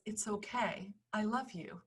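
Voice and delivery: soft, very quiet, tender; tender reassurance. Retold or imitated. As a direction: hush it like comforting a child. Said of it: "look of complete and utter unconditional love".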